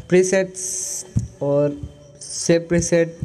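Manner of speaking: ordinary talk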